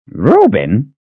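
harumph. Acted. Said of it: "he harumphed".

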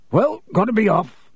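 hearty character voice taking leave; Hagrid, brisk farewell. A narrator's reading. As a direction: announce you are leaving.

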